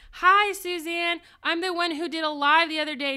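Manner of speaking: high, loud, gushing hello; eager greeting. Retold or imitated. A fan greeting the glitter seller on her live, eager and loud. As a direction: wave down the seller with excitement.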